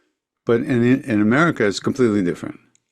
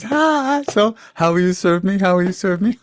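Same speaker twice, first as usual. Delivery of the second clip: high, laughing tease; teasing through a belly laugh; laughing teasing guru; 10 semitones higher than usual. Retold or imitated. Guru laughing at the celibate disciple, high and teasing. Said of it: "and he laughed, and he's slapping his thighs".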